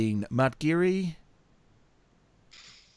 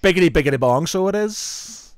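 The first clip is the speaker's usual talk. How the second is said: put-on thick Irish accent; 4 semitones higher, 5 dB louder than usual. Host shows off for friends, hammed-up Irish accent. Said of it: "in a really thick Irish accent".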